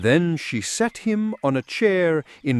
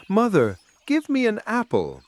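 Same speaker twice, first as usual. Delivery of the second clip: put-on higher, childlike little daughter asking sweetly; little girl voice; 8 semitones higher than usual. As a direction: voice the small daughter begging for an apple. Little daughter asks for apple.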